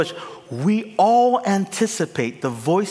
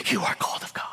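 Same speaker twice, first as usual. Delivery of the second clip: breathy whisper into his ear; mock tender whisper; 5 dB quieter, much faster than usual. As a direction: lean in and whisper it. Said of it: "I will whisper in Moses' ears".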